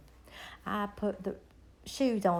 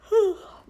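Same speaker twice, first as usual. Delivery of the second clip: loud sigh; 10 semitones higher, 10 dB louder than usual. Real, not acted.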